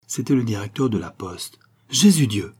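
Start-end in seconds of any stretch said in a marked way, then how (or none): 1.9-2.5 loud, appalled cry; dismayed exclamation. A narrator's reading. Venture hearing who was hanged, loudly dismayed. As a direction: exclaim in consternation. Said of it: "s'écria Venture en se signant d'un air consterné".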